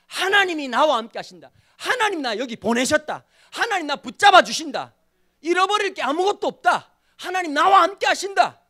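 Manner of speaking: loud, high, mounting proclamation; impassioned declaration. Real, not acted. Pastor voicing Daniel's sole conviction to the congregation, fervent. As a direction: hammer out each declaration of faith with rising force. Preaches at full force.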